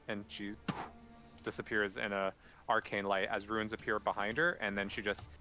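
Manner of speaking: normal talking voice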